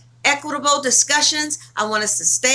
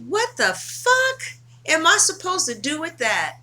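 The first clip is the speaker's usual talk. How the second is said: exasperated; 6 semitones higher than usual. Retold or imitated. Woman recalling her reaction to a crying boyfriend, exasperated. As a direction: stretch it out in baffled exasperation.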